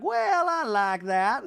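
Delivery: high, slow hammed-up Reba; lofty slow mock-mom rant. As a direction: drone it like a grand old dame. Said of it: "And Reba's like".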